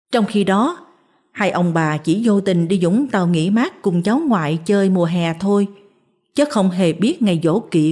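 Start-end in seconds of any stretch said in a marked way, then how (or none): none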